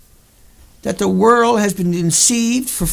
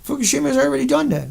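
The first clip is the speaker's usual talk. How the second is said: ordinary talk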